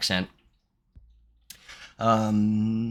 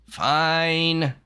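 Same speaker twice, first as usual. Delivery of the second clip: long, high, drawn-out give-in; mock-grumpy, stretched-out agreement; grudging surrender; 7 semitones higher than usual. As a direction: give in like a reluctant sleeper. Acting grudging sleeper giving in.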